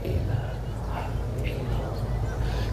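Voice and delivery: slow, soft breathless whisper; voiceless whisper. Shown as a demonstration. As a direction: barely breathe the words out. Tongue only, lips still. (bisikan tanpa suara)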